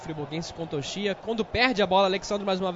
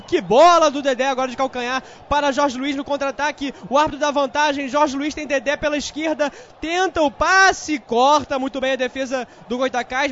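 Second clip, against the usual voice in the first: rising play-by-play; 6 semitones higher, 6 dB louder than usual. Acted. Live attack play-by-play.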